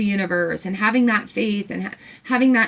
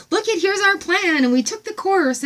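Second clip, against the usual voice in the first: much higher, bright chirpy reply; farm woman quoted; 9 semitones higher than usual. Retold or imitated. Voicing the farm woman answering her, high and chirpy. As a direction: chirp it back delighted. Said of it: "she's like".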